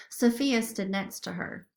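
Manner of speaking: ordinary delivery, nothing marked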